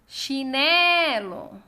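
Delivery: "Chinelo" called loud, NE held long; calling the word. Shown as a demonstration. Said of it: "exercício de chamar as palavras". (chamando "Chinelo", com o NE forte e alongado)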